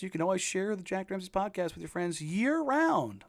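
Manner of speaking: upbeat sales pitch read; infomercial voice. Acted. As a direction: sell it like a late-night TV ad. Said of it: "that's my, uh, what, infomercial voice there".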